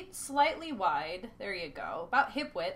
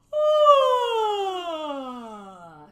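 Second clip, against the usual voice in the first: big open yawn sigh falling from high; 7 semitones higher, 9 dB louder than usual. Shown as a demonstration. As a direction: stretch the palate with a long yawning sigh. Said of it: "let's take a big yawn sigh".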